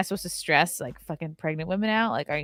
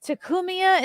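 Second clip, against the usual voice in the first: exasperated rant; 11 semitones higher than usual. Retold or imitated. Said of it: "I'm like".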